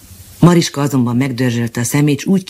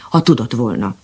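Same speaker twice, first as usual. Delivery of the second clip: plain reading voice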